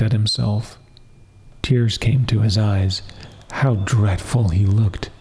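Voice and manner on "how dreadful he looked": usual speaking voice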